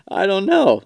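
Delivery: mock high whine on I don't know; high silly voice. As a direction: squeak it out in a put-on voice. First take, high and far.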